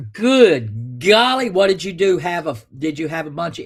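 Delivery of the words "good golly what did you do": slow, drawn-out good golly; amazed. Real, not acted. Host marvelling at caller's bill, slow amazement. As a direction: stretch it in amazement.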